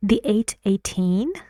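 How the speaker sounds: surprised echo with a steep rise on the stressed end of "eighteen"; rising intonation. Shown as a demonstration. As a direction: draw it out and swing "eighteen" up into a question. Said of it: "باید رایزینگ اینتونیشن رو بگیره".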